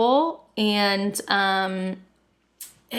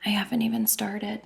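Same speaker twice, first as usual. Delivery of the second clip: sheepish whisper; hushed, embarrassed whisper; 6 dB quieter than usual. Retold or imitated. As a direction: confess it in a whisper. Whispered confession in shower.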